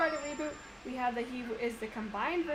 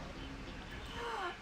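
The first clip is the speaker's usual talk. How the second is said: sharp gasp; 11 semitones higher, 9 dB quieter than usual. Real, not acted. Sudden realization.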